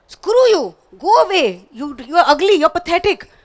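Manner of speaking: loud, high, abusive puppet outburst; insulting puppet. Acted. Puppet abusing audience who asked how are you, insulting.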